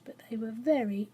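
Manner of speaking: ordinary delivery, nothing marked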